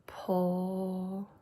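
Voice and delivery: pol- stretched slowly and softly; sounding out words. Shown as a demonstration. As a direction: draw out pol- as a sound chunk. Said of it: "I need to sound out the word polar".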